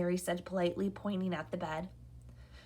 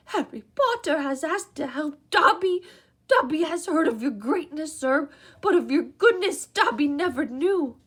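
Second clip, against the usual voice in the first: eager house elf; 8 semitones higher, 9 dB louder than usual. A narrator's reading. House elf meeting Harry, high and eager. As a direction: gush like an awed little elf.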